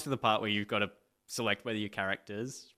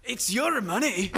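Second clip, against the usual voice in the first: a laughing stab at Billy Connolly, higher; laughing attempt; 11 semitones higher, 6 dB louder, slower than usual. Billy Connolly impression to the interviewer, laughing. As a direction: give up on the Scottish and crack up. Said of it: "Billy Connolly?".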